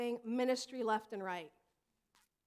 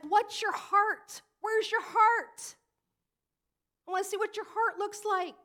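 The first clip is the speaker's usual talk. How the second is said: God's voice; 8 semitones higher, 7 dB louder than usual. Pretend. Preacher voicing God, asking the congregation for their heart. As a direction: stretch it out, searching for the heart. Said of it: "But God's like".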